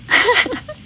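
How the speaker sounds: high burst of real laughter; helpless high-pitched giggle; amused laugh. Real, not acted. Laughs at students' wrong answer.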